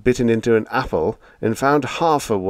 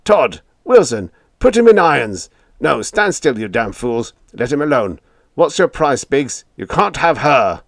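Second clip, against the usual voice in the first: angry shouting; 6 semitones higher, 7 dB louder than usual. A narrator's reading. Skipper raving at crew.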